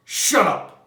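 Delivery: loud, snapped; sharp shout. A narrator's reading. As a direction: cut him off hard. Montag snaps at voice.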